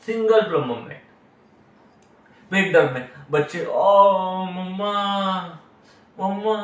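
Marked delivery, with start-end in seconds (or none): 3.6-6.6 slow, drawn-out wail for mum. Retold or imitated. Child with stomach ache crying. (पेट दर्द से रोता बच्चा, धीमा कराहना)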